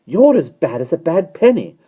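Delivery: high, loud scolding; tart, indignant nanny; snappish. A narrator's reading. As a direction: scold the unwelcome visitor. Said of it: "snapped Mary Poppins".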